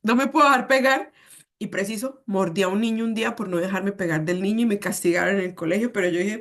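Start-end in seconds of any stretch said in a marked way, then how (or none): 0.0-1.1 put-on loud, higher kid's stubborn line; a stubborn little kid who won't let himself be hit; child proudly repeating his father's rule, loud and high; mock childish insistence. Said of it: "Y yo".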